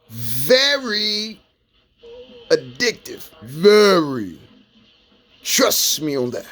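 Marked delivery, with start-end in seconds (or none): 0.1-1.4 Very drawn out and pitched higher; stress on very. Shown as a demonstration. Warning young people, stressing Very as if printed in bold. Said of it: "understand the word very in bold motherfucking letters". 3.4-4.4 Very punched out louder and cut short; stress on very. Shown as a demonstration. Hammering the warning home, Very said loud and firm. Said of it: "the word very in bold motherfucking letters".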